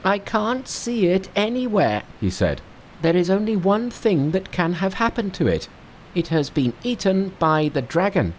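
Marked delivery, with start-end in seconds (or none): 0.0-2.0 high, bright-pitched monkey king. Acted. Narrator voicing Monkey to his master, high monkey voice. 3.0-8.3 high, bright-pitched monkey king. Acted. Narrator voicing Monkey to his master, high monkey voice.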